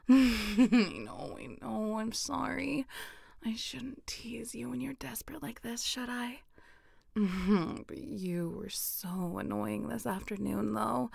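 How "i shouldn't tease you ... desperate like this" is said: soft breathy unvoiced whisper close to the mic; intimate whisper. Partner being teased gently, in an intimate whisper.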